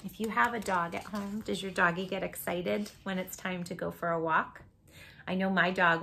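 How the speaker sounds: ordinary delivery, nothing marked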